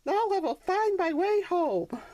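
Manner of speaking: put-on high childlike voice, pleased; hopeful little donkey. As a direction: think you've found it. Sonny confident.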